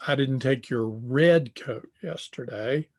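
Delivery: "red" lifted and stressed. Shown as a demonstration. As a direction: hit "red", the one taken was another color. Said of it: "The one I took was blue".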